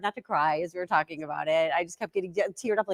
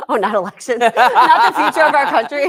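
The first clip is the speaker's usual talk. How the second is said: louder and higher, breaking into laughter; laughing mock disbelief; 7 semitones higher, 10 dB louder than usual. Real, not acted. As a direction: tease with incredulous, laughing questions. Mock shock at co-host's priorities.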